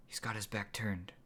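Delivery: Lenny tips off Eric, whispering; whispered. A narrator's reading. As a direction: whisper it to a friend beside you. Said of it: "Lenny whispered to Eric".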